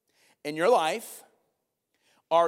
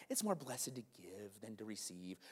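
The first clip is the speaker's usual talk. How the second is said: soft repeat; 7 semitones lower, 16 dB quieter than usual. Shown as a demonstration. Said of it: "not just a Hallmark tagline".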